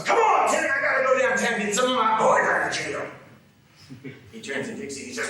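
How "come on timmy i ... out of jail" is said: full-lunged, high-pitched shout; screaming. Acted. Father bursting back into the room, screaming. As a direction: holler it at the top of your lungs. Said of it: "screaming at the top of his lungs".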